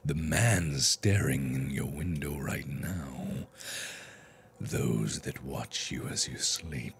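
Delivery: creepy hushed intro. Acted. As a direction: sink into an ominous hush, as if telling nightmares. Creepy intro describing lurking nightmares.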